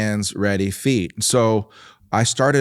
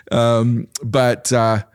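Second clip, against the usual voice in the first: ordinary talk, nothing marked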